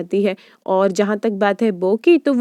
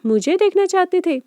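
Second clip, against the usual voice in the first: surprised question; 9 semitones higher than usual. A narrator's reading. Bam surprised that Bo came to see her, rising in disbelief. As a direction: pitch jumps up in disbelief.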